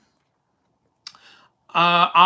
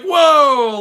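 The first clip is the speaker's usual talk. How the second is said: amazed whoa; 6 semitones higher, 6 dB louder than usual. Retold or imitated. Kid amazed at meeting him.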